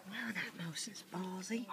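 whispering. Real, not acted. Same remark whispered.

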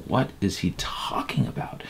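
whispered, puzzled aside to yourself; baffled whisper. Retold or imitated. Confused listener whispering to himself at the sermon.

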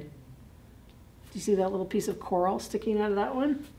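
plain reading voice

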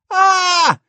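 loud high scream of fright. Acted. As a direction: scream in sudden shock. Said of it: "screamed Sadie, Michael, and Justin".

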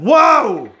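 loud, high "whoa"; startled shout. Real, not acted. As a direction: yelp in sudden alarm. Startled by own bad throw.

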